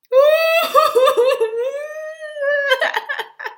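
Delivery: very high, loud wail; child's loud wailing howl. Acted. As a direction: howl like a sobbing little girl. Girl howls louder.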